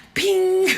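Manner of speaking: high, loud "ping"; imitated ping. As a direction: let out a sudden high ping. Imitates hair popping out.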